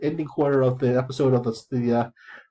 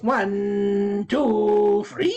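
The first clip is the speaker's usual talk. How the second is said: high rising referee count; 6 semitones higher, much slower than usual. Retold or imitated. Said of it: "the referee goes".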